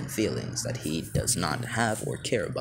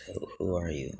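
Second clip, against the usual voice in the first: softer, lower take on the question; deep voice; 5 dB quieter than usual. Acted. Five-year-old wakes and asks the heroes, deep voice. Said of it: "to have such a deep voice".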